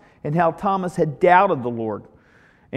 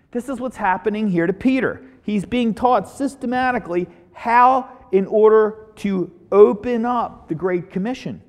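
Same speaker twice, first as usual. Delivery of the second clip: preaching emphasis; 4 semitones higher, 4 dB louder than usual. Acted. Preacher tying Karate Kid to Peter, rising preaching. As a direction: drive the point home from the pulpit. Preacher drives home the lesson.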